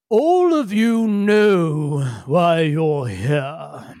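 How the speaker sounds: put-on slow, grave official addressing the kids; slow solemn council voice. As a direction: speak slowly like a stern elder. GM voices council member Feledrin.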